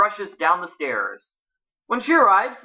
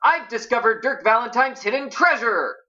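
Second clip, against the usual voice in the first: higher, thrilled exclamation; excited announcement; 7 semitones higher than usual. A narrator's reading. Bess announces the treasure discovery, excited. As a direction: blurt out exciting news.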